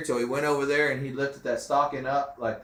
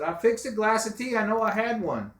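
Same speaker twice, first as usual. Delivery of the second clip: retold protest, voice pitched well up; insisting, higher; 11 semitones higher, 3 dB louder than usual. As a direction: insist you really made it, up high. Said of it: "And I said".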